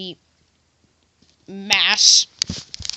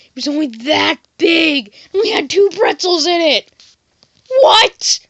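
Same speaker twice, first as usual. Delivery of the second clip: loud, high mock indignation; outraged passenger; 8 semitones higher, 12 dB louder than usual. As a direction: build to a yelled complaint. Acted outraged passenger over pretzel price.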